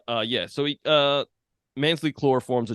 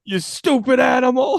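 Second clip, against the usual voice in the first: much higher, loud hammed-up shout; mock furious yell; 13 semitones higher, 9 dB louder than usual. Friend yelling at the giant like at a beast, hammed-up. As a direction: scream it like chasing off a beast.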